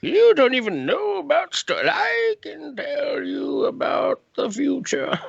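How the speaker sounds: put-on high cracked shouting old scientist; very old Doc Brown. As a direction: crank it up into a frantic old man. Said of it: "my impression of a very old Doc Brown".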